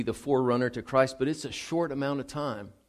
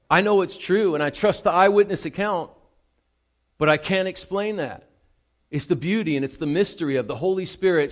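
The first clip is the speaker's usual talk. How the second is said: higher and louder, building the scene with awe; fervent preaching; 5 semitones higher, 5 dB louder than usual. Acted. Pastor to congregation, marvelling at the baptism, fervent preaching. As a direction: preach it at full force to the whole room.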